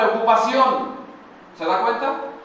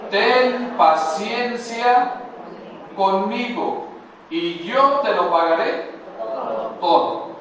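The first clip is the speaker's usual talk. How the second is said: drawn-out plea, slow; slow pleading servant. Shown as a demonstration. Pastor voices the servant's plea slowly for the church. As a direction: stretch the plea out like the begging servant. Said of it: "¿Qué le dijo?".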